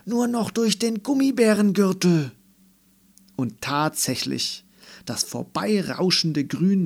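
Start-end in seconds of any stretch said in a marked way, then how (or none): 0.0-2.3 high, loud make-believe pilot cry; excited child's call. A narrator's reading. Melissa points out the gummy bear belt, excited call. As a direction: urge the crew onward, high and loud. Children playing astronauts in narrated story.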